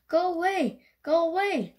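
high, loud storybook shout. A narrator's reading. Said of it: "she shouted".

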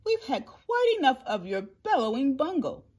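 high, haughty kangaroo scolding; scoff, then tell off the elephant sharply; snapping. A narrator's reading. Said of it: "snapped the big kangaroo".

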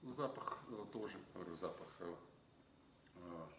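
glass held before mouth; sniffing wine while talking; speaking into wine glass. Real, not acted.

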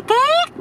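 high, drawn-out squeal of bike brakes. Acted. Storyteller imitating the uncle's bicycle braking, high squeal. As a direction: imitate brakes screeching on one rising note.

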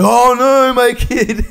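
high, loud fake alarm, laughing; mock panic through laughter. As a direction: ham up a panicked parent. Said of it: "and I'm like".

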